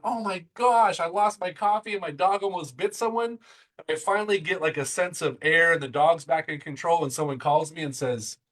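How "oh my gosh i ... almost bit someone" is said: retold panic. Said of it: "I'm freaking out going".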